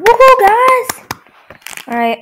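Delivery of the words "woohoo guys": excited cheer. Real, not acted. Cheering after eating candy.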